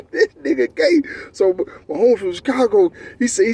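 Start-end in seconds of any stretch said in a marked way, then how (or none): 0.1-1.0 pitched up with a rising finish; giddy repeat. Shown as a demonstration. Repeating the punchline to friends, higher and giggly. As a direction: say it again, higher and amused.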